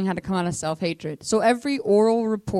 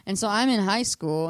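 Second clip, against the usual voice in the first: ordinary talk, nothing marked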